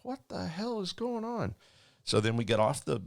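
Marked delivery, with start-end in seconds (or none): 0.0-1.5 much higher, strained exasperated cry; exasperated squeal. Retold or imitated. Attendant exasperated at the silent pilot, high squeal. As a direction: squeeze it out high in frustration. Frustrated on employee bus.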